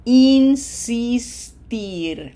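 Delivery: "insistir" drawn out very slowly with smiled ee in every syllable; smiling I sounds. Shown as a demonstration. Teacher modelling an infinitive for learners, "insistir" slow with smiled I's. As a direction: stretch "insistir" into in-sis-tir, each I a clear ee. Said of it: "How many times are you gonna have to smile".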